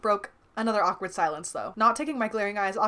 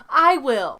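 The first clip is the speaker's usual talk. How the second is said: defiant; 5 semitones higher, 10 dB louder than usual. A narrator's reading. Fight with Liz over John.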